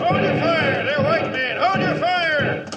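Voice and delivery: very high, slow, loud shouted appeals; shouting to hold fire. Acted. Riders yelling at the soldiers not to shoot. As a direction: bellow across to stop the shooting.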